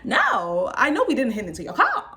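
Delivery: loud, high, cracking up mid-quote; loud comic protest broken by laughs; laughing mock disbelief. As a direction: act incredulous while bursting into laughter. Laughing through their shocked reply.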